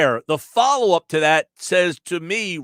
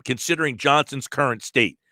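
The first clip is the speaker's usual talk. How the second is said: ordinary talk, nothing marked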